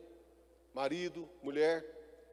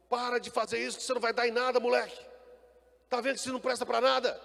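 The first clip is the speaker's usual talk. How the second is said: fast, higher and louder berating; harsh scolding father; 6 semitones higher, 5 dB louder, faster than usual. Retold or imitated. Father berating his son, fast, high and loud. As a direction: snap at the boy like a harsh father. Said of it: "Em vez de você falar desse jeito com seu filho".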